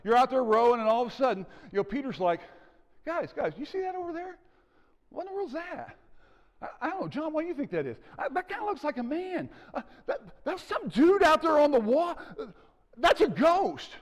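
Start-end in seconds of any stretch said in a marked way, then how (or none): 3.0-13.9 frightened disciples. Retold or imitated. Preacher acting disciples seeing a ghost, high and scared. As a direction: voice the scared fishermen squinting across the water. Disciples spotting figure on water.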